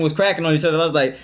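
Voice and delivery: ordinary delivery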